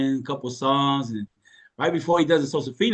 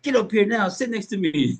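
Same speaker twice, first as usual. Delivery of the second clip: wife yelling; 7 semitones higher than usual. Retold or imitated. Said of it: "I just see my wife yelling at me".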